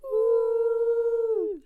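loud high woo; excited cheer. Real, not acted. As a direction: cheer it out big. Show intro cheer.

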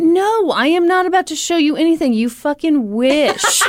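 high, playful protest breaking into laughter; laughing, high-pitched refusal; mock indignation. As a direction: scoff back at a teasing friend, high and amused. Laughing mock refusal of co-host's teasing.

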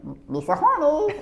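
high, slow Gungan voice; squeaky alien sidekick drawl; Jar Jar Binks voice. Pretend. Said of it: "can you say me, me so horny".

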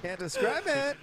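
squeaky high echo; high squeal. Shown as a demonstration. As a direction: squeal it up high, half crying. Squeaky echo of line 138.

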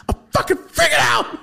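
high, loud mock-panicked shriek; hammed-up friend in a frantic tizzy; imitating frantic freak-out. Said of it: "like a bitch that can't find her pills".

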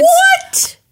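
shocked high "What?"; surprised. Real, not acted. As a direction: react in disbelief. Shocked at seventeen hundreds.

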